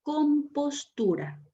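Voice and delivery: lightly voiced strict schoolteacher; mock stern teacher. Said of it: "con su mirada te estaba diciendo la palabra".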